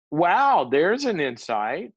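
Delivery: mock sarcastic wonder. Mock self-discovery.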